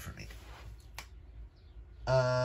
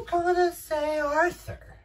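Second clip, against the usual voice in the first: much higher and louder, bursting with excitement; gushing excitement; 5 dB louder than usual. Real, not acted. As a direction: blurt it out like you can't contain yourself. Gushing about favourite characters.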